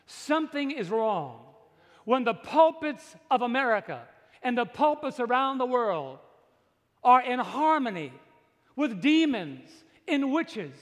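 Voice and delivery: slow, weighty pulpit delivery building to loud; emphatic preaching. Real, not acted. Preacher challenges congregation about pulpits and demons, emphatic preaching. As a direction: drive each phrase home slowly, rising in force.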